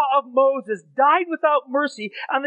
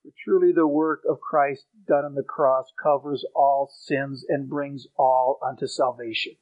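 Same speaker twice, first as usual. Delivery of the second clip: soft earnest preaching; 12 semitones lower, 4 dB quieter than usual. Acted.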